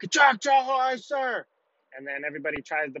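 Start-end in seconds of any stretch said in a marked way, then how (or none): 0.0-1.4 high, strained group response; recruits screaming back. Retold or imitated. Recruits answer their drill instructor, high strained yell. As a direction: imitate recruits yelling the order back. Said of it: "All the recruits scream back".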